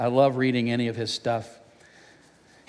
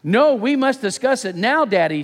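yelling back; 7 semitones higher, 4 dB louder than usual. Acted. Daughter yelling back at dad from bed, high insistent yell. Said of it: "she yelled back".

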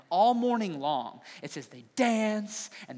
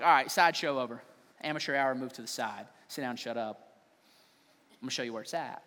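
trash talk; 7 semitones lower, 4 dB quieter than usual. Retold or imitated. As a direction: taunt them with a grin. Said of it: "Elijah is like".

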